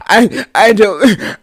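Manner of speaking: loud, stretched-out blubbering imitation; mock sobbing. Storyteller mimics Bodysuits crying, hammed-up sobbing. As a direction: stammer through exaggerated fake tears. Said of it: "I'm talking about fucking crying".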